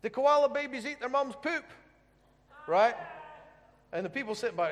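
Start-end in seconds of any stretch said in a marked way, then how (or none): none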